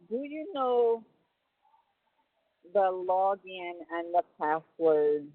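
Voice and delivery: ordinary delivery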